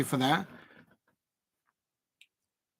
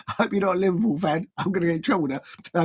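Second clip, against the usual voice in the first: ordinary delivery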